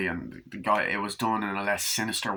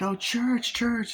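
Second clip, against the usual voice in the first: put-on child's voice; much slower than usual. Child calling the cat.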